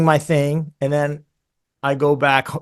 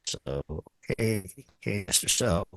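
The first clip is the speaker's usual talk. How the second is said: low agent voice; 5 semitones lower, 10 dB quieter than usual. Retold or imitated.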